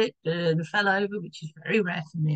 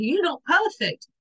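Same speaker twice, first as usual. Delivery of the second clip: a high, mocking jab of self-criticism; inner critic voice; 10 semitones higher than usual. Astrologer voicing Virgo's inner critic to viewers, high and mocking. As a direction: play the nagging inner critic, raised high. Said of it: "that little voice that tells you".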